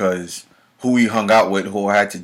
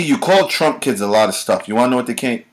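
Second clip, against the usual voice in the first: ordinary talk, nothing marked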